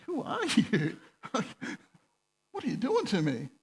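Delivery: confused. Retold or imitated. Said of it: "he's looking at me all confused".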